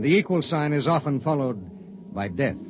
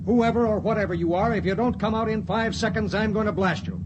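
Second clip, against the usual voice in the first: raised, higher, stern call into the closet; commanding threat; 6 semitones higher than usual. Acted. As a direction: order the hidden stowaway out, ready to shoot. Pilot orders stowaway to come out.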